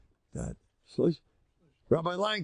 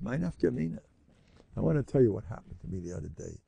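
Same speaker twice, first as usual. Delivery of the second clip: ordinary delivery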